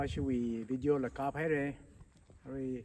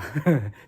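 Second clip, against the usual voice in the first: laugh; 10 dB louder than usual. Real, not acted. Laughs after naming a girl.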